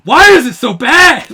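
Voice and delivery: very loud, high imitation of a friend yelling; imitating an anguished, furious yell; mock outraged shout. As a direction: bellow it in hammed-up despair. Imitates mad friend's outburst.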